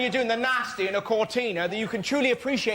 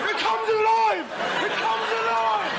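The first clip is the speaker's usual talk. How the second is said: melodramatic proclamation; 11 semitones higher than usual. Acted. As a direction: cry it out like a mad creator. Acted horror-film cry at living vomit.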